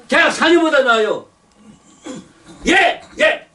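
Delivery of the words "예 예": barked army yes. Retold or imitated. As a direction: snap it out like a recruit to a sergeant. Army recruit answering yes.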